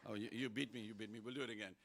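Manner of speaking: ordinary delivery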